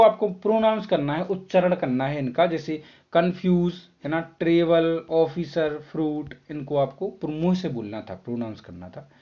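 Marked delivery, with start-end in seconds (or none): none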